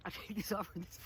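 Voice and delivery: usual speaking voice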